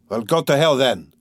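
ordinary delivery, nothing marked

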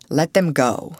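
usual speaking voice

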